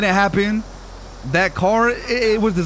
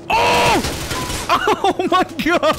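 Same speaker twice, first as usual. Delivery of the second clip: startled cry; 11 semitones higher, much slower than usual. Real, not acted. Sudden game shock.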